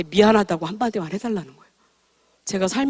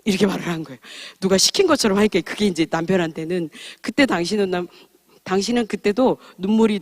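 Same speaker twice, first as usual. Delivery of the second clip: ordinary talk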